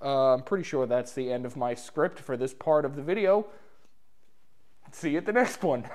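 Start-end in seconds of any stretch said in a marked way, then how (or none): 4.9-5.9 sputtering spit mid-word; spraying spit on the last words; spitting while talking. Real, not acted. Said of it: "I just spit all over myself".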